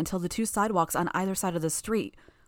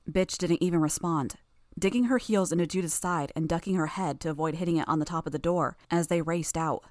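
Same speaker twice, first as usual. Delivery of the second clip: ordinary delivery, nothing marked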